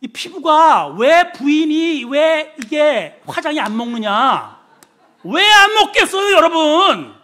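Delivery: loud, high, stretched-out rhetorical outcry; preacher's full-force exclamation; impassioned preaching. Real, not acted. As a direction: belt it out slowly to the congregation, climbing to a peak.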